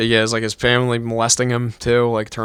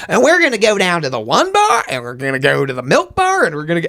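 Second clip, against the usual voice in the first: high mock southern accent, lilting up; thick southern accent; 5 semitones higher than usual. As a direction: imitate a woman chattering in a thick southern accent. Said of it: "as thick as this chick's was".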